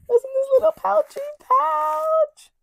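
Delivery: very high, cutesy squeak; squeaky high voice. Pretend. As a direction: squeak it like a cartoon. Repeated in squeaky voice.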